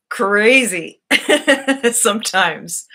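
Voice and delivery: real laugh breaking out between words. Real, not acted. Joking that the vehicle is crazy.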